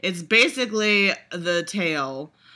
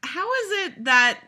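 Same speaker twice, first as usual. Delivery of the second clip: exasperated squeak; 3 dB louder than usual. Real, not acted.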